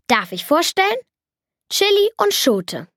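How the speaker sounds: höher, stolz und begeistert vorgestellt; stolze Vorstellung. Acted. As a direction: wie ein Zauberer, der seine Überraschung enthüllt. Jakob präsentiert stolz seine Mäuse. (Jakob stellt seine Mäuse stolz vor, hoch und begeistert)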